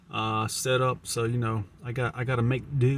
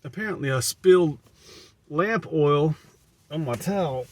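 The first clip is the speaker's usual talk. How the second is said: towel over head; 4 semitones higher, 3 dB louder than usual. Real, not acted. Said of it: "The towel is so thick".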